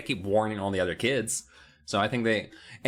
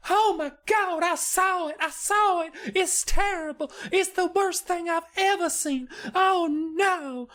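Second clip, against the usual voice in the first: put-on much higher, shaken child's voice; terrified little girl. Alicia telling the girls what she saw, high terrified child voice. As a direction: gasp it out like a kid who saw a monster. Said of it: "And she's like".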